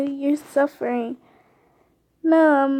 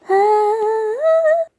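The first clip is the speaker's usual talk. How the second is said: pained cry; 9 semitones higher, 7 dB louder than usual. Acted. Sudden attack.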